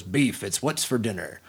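a murmured low voiceover; imitating Robert Mitchum. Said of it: "Robert Mitchum, remember".

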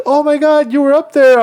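high, loud surprised voice; mock surprised recognition. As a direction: spot someone and squeal. Stranger recognizes him.

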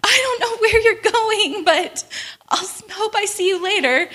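worried friend. Retold or imitated. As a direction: pitch it up like a worried friend calling after you. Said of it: "she's like".

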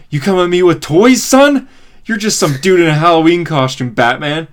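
high, loud mock-villain taunting; hammed-up bragging, rising with outraged swagger; cocky taunt. Shazam's cocky taunt of Batman, acted.

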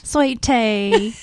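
put-on Southern twang; Southern accent. Shown as a demonstration. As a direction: lean into the Southern vowels. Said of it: "I do get that accent".

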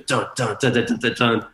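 imitated club drop in punchy syllables; rhythmic musical dun-dun. DJ recounting the club's midnight show, rhythmic dun-duns. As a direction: beat out the hits with the voice.

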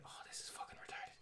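a quiet unvoiced aside to your cohost; whispered aside. Real, not acted.

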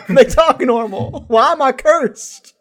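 high, loud, slow mock wail; imagined envious Minnesotan. Host voicing Minnesotans who envy normal talk, comic lament. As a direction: drag it out in hammed-up despair. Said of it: "they're probably like".

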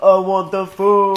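put-on loud, slow, boorish frat-boy rat; booming frat-boy grab for food; greedy frat boy. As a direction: bellow it like a greedy party animal. Frat-boy rat voicing Templeton.

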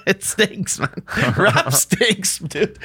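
laughing through the words; giggling hot take. Real, not acted.

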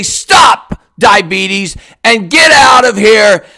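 normal talking voice

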